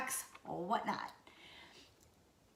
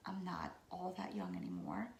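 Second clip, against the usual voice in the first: low breathy whisper, confiding; joking whisper to the camera; whispered aside; 13 semitones lower, 8 dB quieter than usual. Real, not acted. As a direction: let the words drop to a whisper as if telling a secret. Whispered aside about her age.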